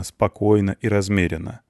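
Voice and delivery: ordinary delivery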